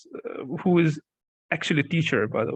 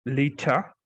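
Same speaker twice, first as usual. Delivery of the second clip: British pronunciation of "later", no R at the end; non-rhotic British "later"; British accent. Shown as a demonstration. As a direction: say "later" the British way, ending in an open "uh". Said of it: "if you're British, like, you can say it like".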